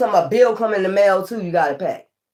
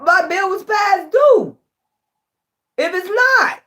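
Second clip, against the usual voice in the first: ranting at the billing company, high and incredulous; mock outraged; 9 semitones higher, 3 dB louder than usual. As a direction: squeal it in disbelief.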